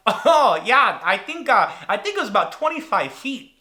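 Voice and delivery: a hint of a chipper Midwesterner; guy from Michigan. Michigan bystander estimating the distance, lightly imitated. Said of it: "some guy from Michigan".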